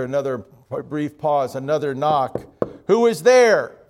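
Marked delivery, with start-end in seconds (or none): 2.9-3.8 louder, higher challenge; calling through door. Acted. Priest behind locked door demanding who knocked, calling out. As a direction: call out to whoever is knocking.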